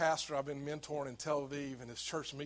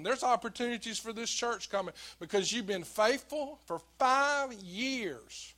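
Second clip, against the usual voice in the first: louder, rising sermon close; preaching exhortation; 4 semitones higher, 5 dB louder than usual. Acted. Pastor encouraging the church over music, preaching with force. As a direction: lift the room with promise.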